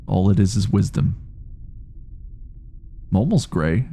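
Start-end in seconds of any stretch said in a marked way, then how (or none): none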